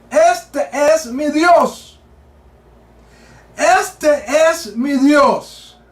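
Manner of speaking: each word drawn out slowly, solemn; preacher declaiming a verse slowly; slow solemn quote. Acted. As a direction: proclaim the verse very slowly, with weight.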